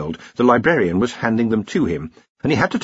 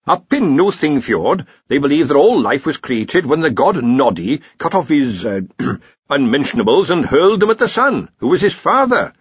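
louder, higher lecturing wizard; senior wrangler; 6 semitones higher, 6 dB louder than usual. Acted. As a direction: recount a myth with loud relish. Senior wrangler recounts a myth.

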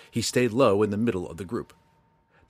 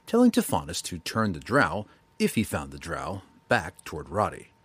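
ordinary delivery, nothing marked

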